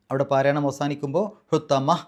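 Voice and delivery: usual speaking voice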